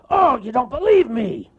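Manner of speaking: angry cartoon bear roar. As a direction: bellow it out, offended. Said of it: "roared the bear".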